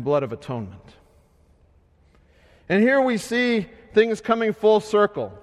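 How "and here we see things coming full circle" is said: usual speaking voice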